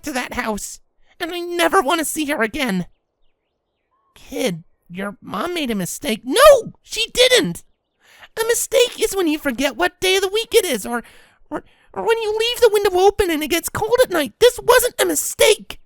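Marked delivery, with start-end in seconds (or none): 6.3-15.8 put-on young filly angrily insisting; upset filly. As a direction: fight back tearfully.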